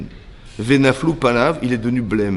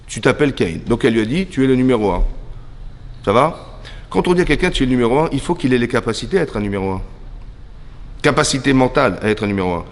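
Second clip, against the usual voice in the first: normal talking voice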